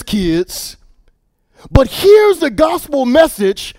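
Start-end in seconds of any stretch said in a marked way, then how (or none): 1.7-3.7 higher, louder preaching; preacher lifting the crowd with louder, higher voice. Acted. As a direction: build to the good news. Preaching at full force.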